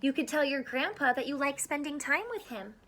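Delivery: lively picture-book read-aloud; reading to children. Acted. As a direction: read it out to a class of small kids. Reads picture book to kids.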